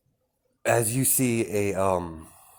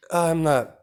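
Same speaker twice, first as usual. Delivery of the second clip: nervous laugh; 9 semitones higher, 5 dB louder than usual. Acted. Lawyer laughs mid-apology.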